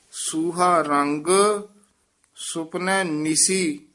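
ਸੂਹਾ ਰੰਗ, a clear pause, then ਸੁਪਨੇ ਨਿਸੀ; careful pause placement. Shown as a demonstration. Teacher showing the visram, pause after ਸੂਹਾ ਰੰਗ. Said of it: "ਇਹ ਧਿਆਨ ਨਾਲ ਵਿਸਰਾਮ ਲਾਉਣਾ ਹੈ".

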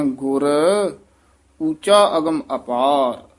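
ਗੁਰ, long stop, then ਉੱਚਾ ਅਗਮ ਅਪਾਰ; pause after ਗੁਰ. Shown as a demonstration. Teacher shows the pause after ਗੁਰ, clear. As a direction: hold still after ਗੁਰ before the rest. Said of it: "ਗੁਰ ਤੇ ਯਮਕ ਲਾਉਣੀ ਹੈ".

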